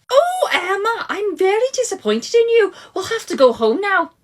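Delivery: high, tearful mother's voice; a mum near tears over her daughter; disappointed mum. Acted. Mum scolding her excluded daughter, high and tearful.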